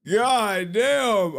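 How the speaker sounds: high, laughing retold disbelief; amused mock-wounded exclamation; amazed dismay. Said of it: "It's like".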